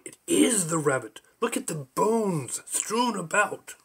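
slow, high theatrical bellow; hammed-up cry, stretching the key words. A narrator's reading. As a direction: bellow it dramatically, drawing words out. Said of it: "Timmy and Cheryl bellowed".

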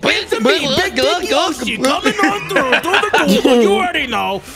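loud, high, slow and laughing; hype yell. Acted. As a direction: chant it like a hype man. Joining the hype chant.

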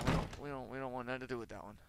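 usual speaking voice

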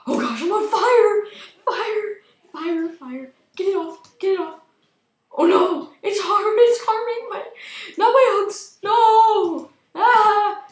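high, loud panicked screaming; screaming on fire. Real, not acted. Character on fire in game.

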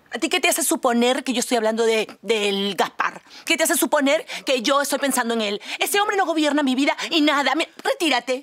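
offended outburst. Acted. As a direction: bristle and push him away. She snaps back, offended. (arrebato indignado)

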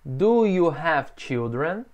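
slow, clear repeat-after-me question; teacher modelling slowly; slow model question. Shown as a demonstration. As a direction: say it slowly for students to copy.

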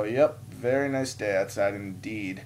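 slow, understated echo of his small talk; reluctant polite reply. Retold or imitated. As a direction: answer slowly, just humouring him. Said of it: "And I go".

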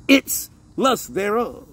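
slow, stretched scripture phrase; slow reading. Real, not acted. Preacher finishing the verse for listeners, slowly. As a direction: linger over each word of the verse. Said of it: "I'm gonna read it real slowly".

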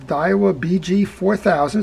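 normal talking voice

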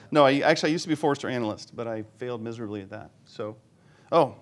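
normal talking voice

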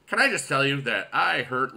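usual speaking voice

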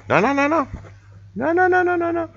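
cooing no. Real, not acted. Owner coos high at her cat.

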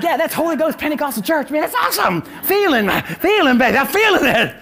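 high, laughing, hyped-up praise voice; a Pentecostal feeling the spirit, loud and giddy; excited churchgoer. Retold or imitated. Preacher mimics excited churchgoers, loud and laughing. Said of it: "We'd be like".

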